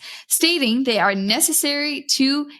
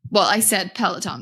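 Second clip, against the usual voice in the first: ordinary talk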